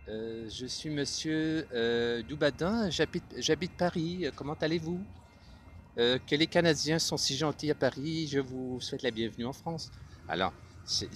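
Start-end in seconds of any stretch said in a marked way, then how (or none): 0.1-9.9 high, lilting Parisian French voice; Parisian French accent. Shown as a demonstration. Parisian gentleman welcomes Canadians to France, high-toned French accent. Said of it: "c'est un peu comme ça".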